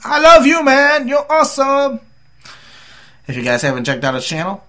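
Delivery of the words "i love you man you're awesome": high, loud burst of affection; excited shout-out. Real, not acted. Vlogger praising a subscriber, loud high excited shout. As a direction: yell your love to a buddy.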